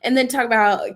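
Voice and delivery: ordinary talk, nothing marked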